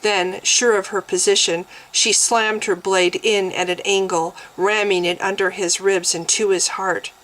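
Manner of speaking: plain reading voice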